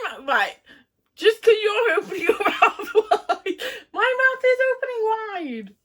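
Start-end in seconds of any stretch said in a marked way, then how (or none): 1.2-5.7 high, loud laughing. Real, not acted. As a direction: laugh through the words. Mouth open for catch.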